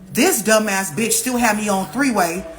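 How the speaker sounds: ordinary delivery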